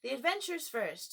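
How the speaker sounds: brushing the question aside impatiently. A narrator's reading. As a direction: cut them off, you want the adventures now. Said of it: "in an impatient tone".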